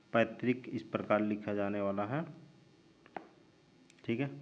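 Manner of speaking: ordinary talk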